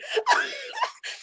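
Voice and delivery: squealed laugh trailing off; real giggle; high giggle. Real, not acted. Laughing at herself.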